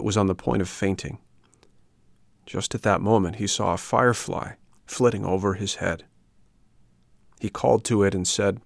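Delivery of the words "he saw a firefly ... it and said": plain reading voice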